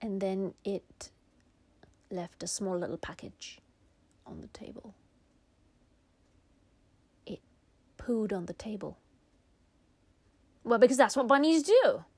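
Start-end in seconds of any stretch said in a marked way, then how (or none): none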